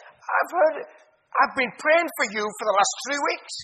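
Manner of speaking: light up, you've prayed for him; excited greeting. Retold or imitated. Ronnie greets Billy, voice raised.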